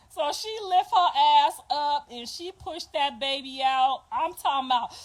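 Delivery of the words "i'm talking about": usual speaking voice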